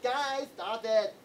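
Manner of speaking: slow, drawn-out pleading complaint; scrunched-up protest. Retold or imitated. Teased kid protesting to the group, slow scrunched-up complaint. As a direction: imitate a teased kid, dragging out each word. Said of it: "he'd get that face on".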